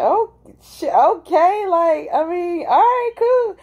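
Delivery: high, drawn-out squeal of agreement; acted-out high-pitched "okay, cool"; flustered acceptance. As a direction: recount getting giddy, voice shooting way up. Acting out her flustered reaction.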